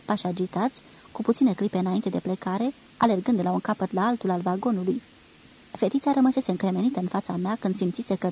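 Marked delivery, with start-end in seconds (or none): none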